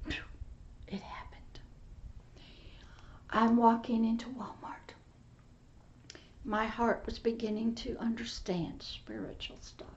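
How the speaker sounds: breathy hushed testimony; hushed whisper. Real, not acted. Preacher whispering his testimony to the congregation. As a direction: whisper it like a sacred secret.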